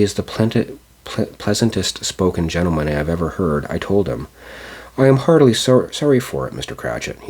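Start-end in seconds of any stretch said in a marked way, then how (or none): 5.0-7.2 heartfelt sympathy. Acted. Nephew's kind condolence quoted.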